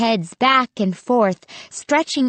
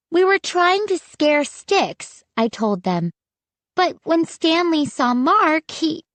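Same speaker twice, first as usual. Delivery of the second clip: anxious explaining; 8 semitones higher than usual. A narrator's reading. Narrator explains, voice trails off.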